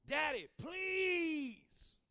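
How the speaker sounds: high, loud begging shout, stretched out slowly; Jesus crying out to his Father in anguish, begging; desperate cry. Acted. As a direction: plead with your Father at the top of your voice. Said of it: "He cried".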